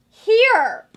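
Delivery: loud, high cry of disbelief; exasperated outburst. Real, not acted. Exasperated disbelief at her correct prediction.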